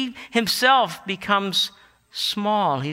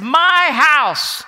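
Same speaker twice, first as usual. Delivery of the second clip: loud, high commanding proclamation; fierce divine claim, ringing out; Jesus declaring; 5 semitones higher, 8 dB louder than usual. Retold or imitated. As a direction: thunder it out as the owner of the temple. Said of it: "and he says".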